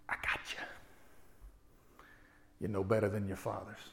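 whispered, low menacing threat; dark voice. Retold or imitated. The dark voice in the attorney's office, whispered and menacing. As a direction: breathe it like an evil whisper. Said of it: "that voice said".

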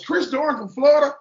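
a mock double take at the name; surprised mom. Mom hears a name she knows and answers, surprised.

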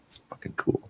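dry whispered aside, almost no voice; sarcastic whisper. Real, not acted. Joking about jobless people after robot warehouses, a sarcastic whisper. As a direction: breathe it out as a deadpan joke.

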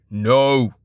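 firm, restrained shout; mild shout in a resonant voice; merchant's shout. A narrator's reading. Merchant shielding his girl, restrained shout. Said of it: "the orange-robed merchant shouted".

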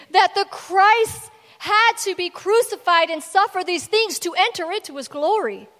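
loud, emphatic, rising exhortation; forceful rebuke. Acted. Jesus rebuking the disciples on the road, forceful.

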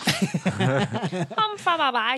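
"Come from away" slow, woozy garble; imitating reversed speech. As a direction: stretch out "Come from away" as mangled backwards talk. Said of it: "This is you".

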